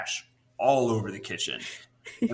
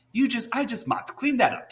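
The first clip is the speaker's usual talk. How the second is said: exasperated mom; 13 semitones higher, 3 dB louder than usual. Retold or imitated. Mother scolding in retold story.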